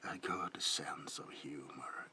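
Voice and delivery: normal talking voice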